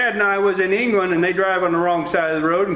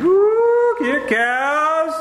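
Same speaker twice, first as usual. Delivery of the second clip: calling cows; 8 semitones higher, much slower than usual. Retold or imitated. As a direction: hoot out to the herd like a farmer.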